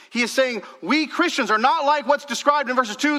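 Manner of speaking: high, loud proclamation; full-force preaching. Real, not acted. Preacher declares Paul's warning to the church, forceful preaching. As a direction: drive each phrase home from the pulpit.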